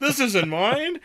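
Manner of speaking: high, slow, laughing fake innocence; laughing mock denial. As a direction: play the guilty denier, cracking up.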